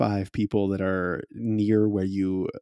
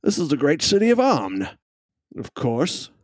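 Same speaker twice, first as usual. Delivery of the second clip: fussy gentleman; 4 semitones higher than usual. Acted. Gentleman NPC voice.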